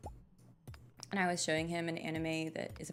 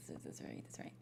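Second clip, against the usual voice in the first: very soft, low whisper; whispered self-talk; hushed aside; 12 dB quieter than usual. Real, not acted. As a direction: murmur it to yourself. Muttered aside during the game.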